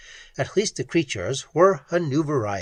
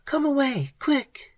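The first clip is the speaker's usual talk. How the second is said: much higher, frightened whimper; 13 semitones higher than usual. Acted. Pat to Ham, frightened whimper.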